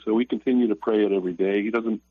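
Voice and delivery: ordinary talk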